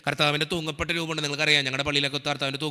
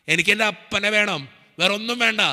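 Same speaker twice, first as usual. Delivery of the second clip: higher, louder retold plea to God; pleading prayer; 4 semitones higher, 3 dB louder than usual. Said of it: "ഞാൻ പ്രാർത്ഥിച്ചത് ഇങ്ങനെയാ".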